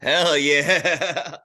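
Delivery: loud, high cheer; excited cheer. Real, not acted. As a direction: whoop it up. Cheering the song pick.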